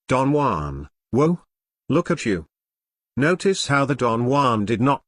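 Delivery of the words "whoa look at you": ordinary talk, nothing marked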